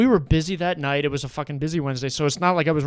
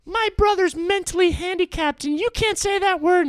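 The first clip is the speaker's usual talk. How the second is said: hammed-up offence, pitched way up; mock-offended friend; 4 dB louder than usual. Kid's friend at the table objects, mock-offended. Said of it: "his friend at the table is like".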